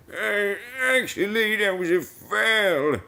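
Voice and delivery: high, slow put-on correction; high-pitched pedantic interjection; objecting onlooker. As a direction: play a second voice objecting, drawn out. Answers own acted ironic example.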